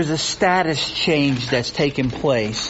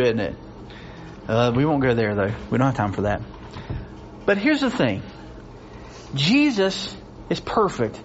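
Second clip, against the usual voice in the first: usual speaking voice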